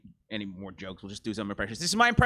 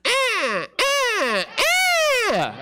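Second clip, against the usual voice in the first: Oprah as a goose; much slower than usual. Pretend. Comedian doing Oprah as a goose, honking high. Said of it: "This is Oprah Winfrey as a goose".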